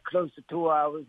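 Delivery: usual speaking voice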